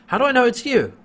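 mock-suspicious, calling out the question; suspicious challenge. Chiana suspicious at docking bay.

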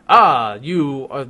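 loud, drawn-out mock praise; put-on, over-the-top approval; hammy congratulation. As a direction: stretch out the last words grandly. Acts out Crichton's bluffing congratulation.

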